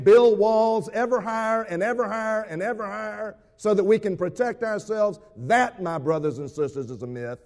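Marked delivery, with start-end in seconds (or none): 0.0-5.2 insistent, higher-pitched preacher's piling-up; rising rhetorical crescendo. Acted. Preacher challenging the congregation about building walls for protection, insistent crescendo. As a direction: build each repeat higher and louder, rushing on.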